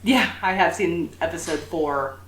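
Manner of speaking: usual speaking voice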